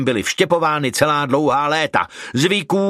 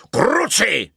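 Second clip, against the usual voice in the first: mild roar; 9 semitones higher than usual. A narrator's reading. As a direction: burst out in irritation, not full volume. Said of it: "zařval Koukr". (mírně zařvané zaklení)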